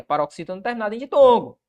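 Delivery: usual speaking voice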